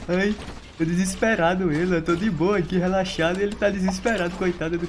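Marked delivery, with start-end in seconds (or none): none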